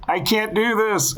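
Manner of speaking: high, slow mocking echo of his line; mock heckle. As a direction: jeer it from the back of the room. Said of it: "someone in the back of the room would go".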